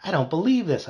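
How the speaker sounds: high, delighted disbelief; gleeful. A narrator's reading. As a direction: relish it with glee. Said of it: "I thought gleefully".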